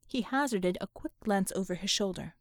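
ordinary delivery, nothing marked

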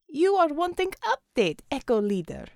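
a Russian commander speaking English; Russian accent. Acted. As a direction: lay on a thick Russian accent. Russian figure Xavier.

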